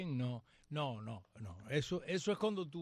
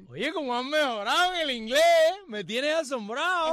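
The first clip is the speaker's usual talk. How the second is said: mock amazement; 8 semitones higher, 8 dB louder than usual. Mock praise of bad English.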